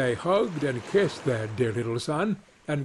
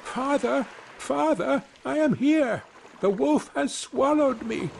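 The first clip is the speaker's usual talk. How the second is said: very high little voice calling for father; high-pitched storybook cry for help; 11 semitones higher than usual. A narrator's reading. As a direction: call out high from a small body. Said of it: "cried out".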